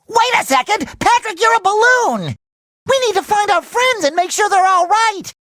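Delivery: high, excited exclamations; urgent alarm. Acted. To Patrick, friends may need help, urgently alarmed. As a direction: cry out with rising urgency.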